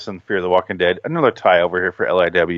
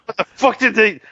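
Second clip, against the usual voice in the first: high-pitched exasperated outburst; retold anger; 12 semitones higher than usual. Reviewer listening back to his Walking Dead review, mock furious. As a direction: replay a mad rant, cut short. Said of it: "Oh, I'm so mad".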